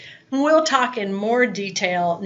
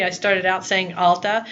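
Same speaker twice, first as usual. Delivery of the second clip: ordinary talk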